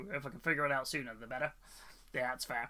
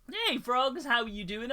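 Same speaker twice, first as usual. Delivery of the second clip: cheery greeting; 8 semitones higher, 3 dB louder than usual. Real, not acted. Greeting chat, high and bright.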